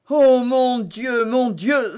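high, loud, slow cry to God; anguished cry. A narrator's reading. Milady cries out to Felton in anguish. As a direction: wail it out in despair. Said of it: "s'écria Milady".

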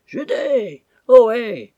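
high, loud drawn-out call across water; doleful hail. A narrator's reading. Captain adrift in the dock hailing his ship, doleful hail. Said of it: "Une voix dolente s'éleva du milieu du bassin".

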